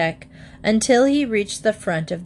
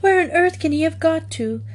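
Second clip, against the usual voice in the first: worried; 9 semitones higher than usual. A narrator's reading. Mrs Bird worried about Paddington.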